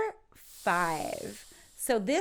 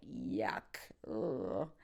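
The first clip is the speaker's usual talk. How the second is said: much lower yuck of disgust; 11 semitones lower, 6 dB quieter than usual. Shown as a demonstration. Tasting a failed meal, a low disgusted yuck. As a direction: drop it low like a bad taste. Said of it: "doesn't turn out to be delish".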